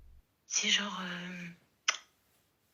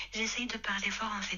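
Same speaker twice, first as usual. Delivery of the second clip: usual speaking voice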